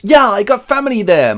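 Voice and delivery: high, loud excited Gimli; eager dwarf. Acted. Dwarf welcomes route through Moria, high excited shout. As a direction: pipe up keenly, loud and high. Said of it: "the dwarf Gimli goes".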